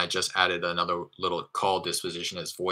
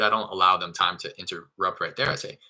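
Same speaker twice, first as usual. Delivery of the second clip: usual speaking voice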